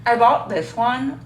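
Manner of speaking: talking through a toothbrush; while brushing teeth. Real, not acted. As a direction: mouth full of toothpaste. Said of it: "I'm brushing my teeth".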